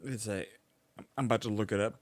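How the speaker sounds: normal talking voice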